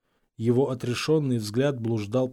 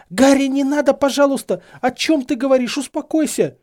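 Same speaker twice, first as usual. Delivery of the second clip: high, loud, urgent appeal; agitated pleading; 13 semitones higher, 8 dB louder than usual. A narrator's reading. Hermione begging Harry to stop, high and frantic. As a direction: beg him to calm down.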